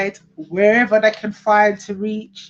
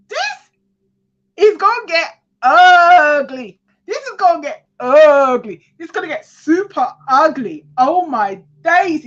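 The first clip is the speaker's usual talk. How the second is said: high, loud, worked-up exclaiming; excited; 9 semitones higher, 6 dB louder than usual. Real, not acted. Reader foreseeing an ugly falling-out, excited. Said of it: "but I get excited".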